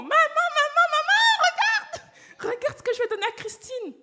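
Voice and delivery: put-on high, loud child shouting for mum; excited child shout. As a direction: burst in thrilled to show mum. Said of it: "en courant".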